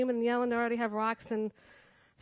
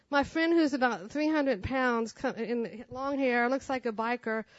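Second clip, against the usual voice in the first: ordinary talk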